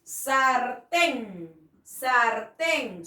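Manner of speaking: sartén with a light tapped r; sartén, r soft and brief, drawn out; soft r demo. Shown as a demonstration. Teacher sounds out sartén for the class. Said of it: "suena suave".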